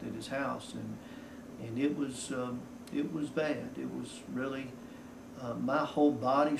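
ordinary talk

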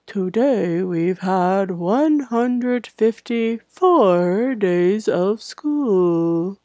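slow, whispered robot monotone. Shown as a demonstration. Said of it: "Now I sound like a robot".

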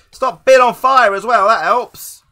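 high, very loud scolding; loud, exasperated outburst; exasperated shout. Real, not acted. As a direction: yell at yourself in frustration. Scolding own burning game character.

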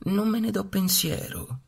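high, lightly voiced mother duck; mamma anatra. A narrator's reading. (mamma anatra noncurante, voce alta)

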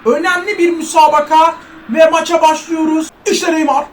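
slow announcer opening; hammed-up sports commentator intro; mock commentator. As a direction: open the match like a TV commentator. Commentator-style match intro.

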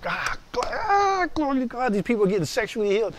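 high, soft gushing praise; mock-swooning glory cry, kept soft; retold overjoyed praise. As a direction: act out being thrilled for them, voice lifting high. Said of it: "I was just so happy for them".

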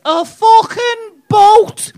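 high, slow, shouted mock Scouse; bad Liverpool accent. Liverpool heckler boasting to comedian, shouted in bad Scouse. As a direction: yell it in a hammed-up, squeaky Liverpool accent. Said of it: "worst excuse at your accent".

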